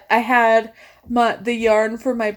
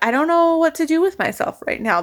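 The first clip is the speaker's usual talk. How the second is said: overwhelmed; 7 semitones higher than usual. Retold or imitated. Retold overwhelmed reaction.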